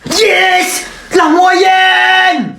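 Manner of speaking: high, thrilled yell; excited shout. Acted. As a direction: cheer it out high. Celebrating passing grade.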